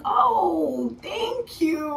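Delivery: high, drawn-out stunned thanks; retold shock. Patient answering doctor's congratulations, high shocked voice. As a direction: imitate being thrown by the news. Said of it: "Like, I'm so shocked".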